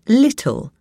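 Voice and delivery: "little" with a crisp T, not a D; clear T. Shown as a demonstration. Reader teaching the Queen's English, "little" with clear T. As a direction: say "little" with the T fully sounded. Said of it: "say the T clearly".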